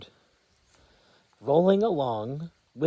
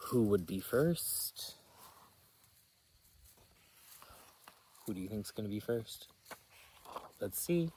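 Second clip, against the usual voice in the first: hushed question; 4 semitones lower, 10 dB quieter, faster than usual. A narrator's reading. Reader asks child, hushed.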